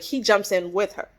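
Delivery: ordinary talk, nothing marked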